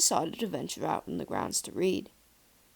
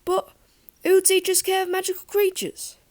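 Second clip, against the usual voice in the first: dismayed protest; 13 semitones higher, 7 dB louder than usual. Acted. Boy protesting teacher leaving.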